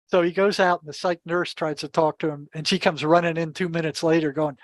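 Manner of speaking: ordinary talk, nothing marked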